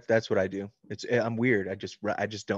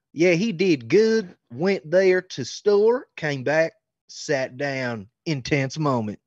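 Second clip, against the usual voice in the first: put-on loud, drawn-out Southern accent easing toward the end; 6 semitones higher, 6 dB louder, much slower than usual. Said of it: "I did that in a Southern accent".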